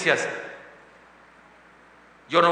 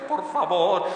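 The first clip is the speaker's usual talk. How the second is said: mock anguish; much slower than usual. Shown as a demonstration. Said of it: "como angustia".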